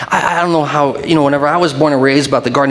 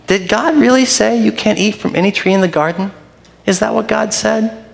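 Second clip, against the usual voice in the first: an honest, curious query, pitched higher; innocent question; 7 semitones higher than usual. Shown as a demonstration. Preacher reading the serpent's words to Eve as an innocent question. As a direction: ask it as if you're only asking a question. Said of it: "there's a couple ways you could say that".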